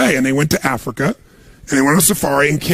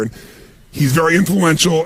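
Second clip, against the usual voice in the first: ordinary delivery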